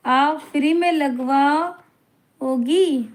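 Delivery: आप फ्री में लगवाओगी drawn out very slowly, stretching में; slow incredulous repeat. Retold or imitated. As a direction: stretch it out in mock disbelief.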